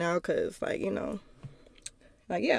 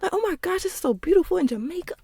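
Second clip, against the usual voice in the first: gushing; 7 semitones higher than usual. Retold or imitated. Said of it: "I was like".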